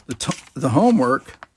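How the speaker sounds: usual speaking voice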